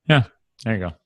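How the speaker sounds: ordinary talk, nothing marked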